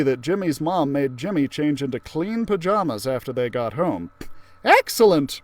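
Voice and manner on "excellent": cheerful teacher voice. Acted. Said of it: "Miss Frizzle said".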